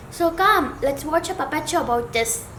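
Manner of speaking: plain reading voice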